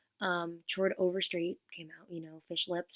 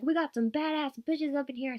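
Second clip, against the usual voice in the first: higher, louder crowd-rousing quote; imitated hype shout; 8 semitones higher, 8 dB louder than usual. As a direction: mimic a singer hyping up the crowd. Acting out singer hyping the crowd.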